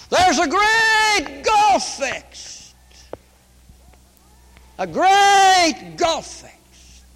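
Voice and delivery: high, slow, crying preacher's wail; a revival preacher's slow, high, crying lament; full-force preaching. Real, not acted. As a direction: draw out each word in anguish. Preaching climax on Dives and Lazarus.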